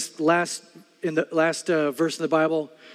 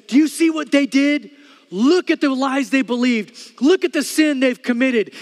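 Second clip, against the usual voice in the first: accusing devil; 6 semitones higher, 4 dB louder than usual. Retold or imitated. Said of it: "he stands before God and he says".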